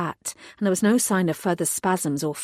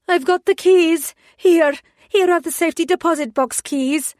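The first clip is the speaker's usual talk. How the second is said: much higher, loud anxious call; voice ringing out; 10 semitones higher, 7 dB louder than usual. Acted. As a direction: ring out to get the robbers' attention. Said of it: "the bank teller's voice rang out".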